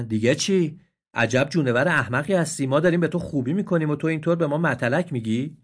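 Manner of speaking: irritated donkeys. Acted. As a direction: scold the ungrateful deer. Donkeys angered. (خرها با خشم)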